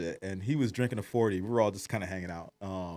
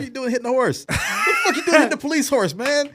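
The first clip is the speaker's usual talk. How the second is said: much higher, louder, laughing disbelief; incredulous scolding; 6 dB louder than usual. Retold or imitated. Scolding Gene for punching the police horse, incredulous yell. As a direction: yell at your friend in disbelief, half laughing.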